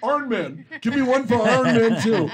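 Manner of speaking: mock Pittsburgh shout for tickets; Pittsburgh accent. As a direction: call out in a Pittsburgh accent, iron as "Arn". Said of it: "in Pittsburgh they call it Arn".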